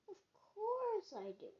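high-pitched huffy comeback; indignant, voice jumping up on the retort. Acted. Toad retorts to Frog's teasing.

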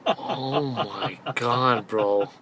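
very slow, low and soft; low, drained disbelief; stunned dismay. Real, not acted. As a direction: drag it out quietly, aghast. Aghast after hearing the story.

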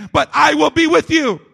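preacher booming the last promise; ringing proclamation. Acted. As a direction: shout the promise out over the congregation. Climax of repeated promise.

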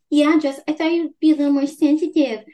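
high, prim mock of a disapproving mother; mocking mom voice. Mom chiding Jess at dinner, in a high prim mock. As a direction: put on a prissy, scolding mom tone, pitched well up. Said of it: "And then the mom's like".

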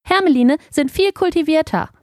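high-pitched, self-satisfied ermine; puffed-up small creature boasting; proud. A narrator's reading. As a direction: declare it with haughty little pride. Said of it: "Stolz legte es die Vorderpfote".

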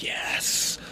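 hushed, voiceless "yes"; mock-heroic whisper. Baywatch guy running down the beach, whispered mock triumph. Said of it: "like, full on, like".

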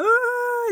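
high excited fan shriek. Retold or imitated. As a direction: squeal like a thrilled fan. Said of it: "elle est là". (cri aigu de fan)

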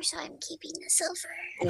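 hushed greedy whisper; whispered aside. Acted. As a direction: whisper it to yourself.

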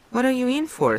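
high, pressing question; lightly voiced anxious prisoner; Winston, urgent. A narrator's reading. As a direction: ask it fast and tense.

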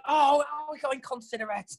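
lightly sarcastic self-correction; mock realisation. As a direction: act out realising you were wrong. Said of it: "I'm like".

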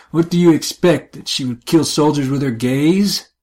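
loud, scornful retort; angry laughter. Acted. Scornful retort after bitter laugh.

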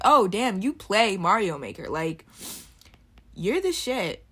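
loud, impressed exclamation. Acted. Viewer won over after watching the streamer play, impressed. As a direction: burst out, won over by the skill. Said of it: "watch his shit and it's like".

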